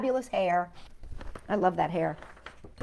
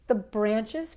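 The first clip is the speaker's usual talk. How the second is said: usual speaking voice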